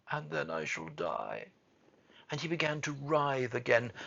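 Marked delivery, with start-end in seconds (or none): 0.1-1.5 low, soft, despairing end; choked-out lament. A narrator's reading. Boy sure he will die, choked and despairing. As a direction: sink down into doom. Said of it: "choked out Colin".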